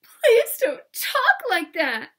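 loud, high, drawn-out crying voice; bawling childlike plea; tearful plea. Acted. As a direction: beg through hammed-up tears. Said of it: "Pippi burst out crying".

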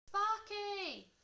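high, loud, drawn-out name call; crying out. A narrator's reading. George to his crashing dragon, crying out. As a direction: cry the name out in alarm. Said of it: "cried George".

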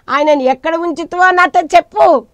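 high, loud acted plea; preacher voicing an insistent plea; urgent demand. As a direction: raise the voice, demanding an answer. Voices Mary's demand to the gardener.

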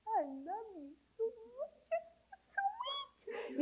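put-on high, soft cooing baby talk. Shown as a demonstration. Mother cooing to her little child, baby talk. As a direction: coo it sweetly in a tiny high voice. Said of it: "hear your mother talking to you in that way".

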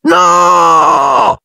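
frightened shouted no. A narrator's reading. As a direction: yell it as the wall rushes at you. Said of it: "gridò Ron".

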